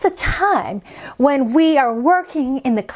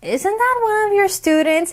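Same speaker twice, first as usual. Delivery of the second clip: plain reading voice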